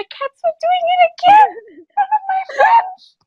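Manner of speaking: very high, squealing embarrassment; embarrassed laughing. Real, not acted. Telling friends the cats did it again, embarrassed squeal. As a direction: squeak it out while cracking up. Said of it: "I was so embarrassed".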